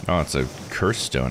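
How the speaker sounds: ordinary talk, nothing marked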